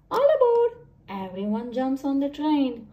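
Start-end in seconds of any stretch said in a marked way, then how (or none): 0.1-0.9 loud, high all aboard call. Acted. Henny calling everyone aboard the train, loud and high.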